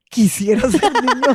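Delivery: a lightly voiced little boy wishing aloud; light mock boy's voice; child's wish. Child's wish repeated, mimicked voice.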